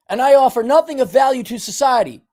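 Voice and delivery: high, forceful recitation to be repeated; emphatic self-rebuke. Shown as a demonstration. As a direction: declare it high and hard, like you mean it. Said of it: "say it like you mean it".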